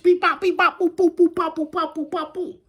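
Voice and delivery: high, rhythmic mouth-made pops; vocal sound effects of a flurry; imitated rapid-fire popping. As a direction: rattle off punchy high-pitched beeps and boops. Acts out popping off.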